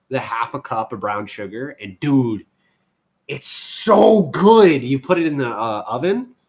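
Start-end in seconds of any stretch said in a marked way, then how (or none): 3.3-4.8 slow, high and loud savoring; swooning over the taste; drawn-out rave. Real, not acted. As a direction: stretch it out like it's the best thing ever. Raving about glazed bacon.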